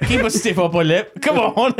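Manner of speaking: put-on rally them in a jokey British accent; mock British accent. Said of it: "It's stiff".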